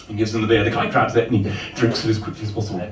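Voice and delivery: ordinary talk, nothing marked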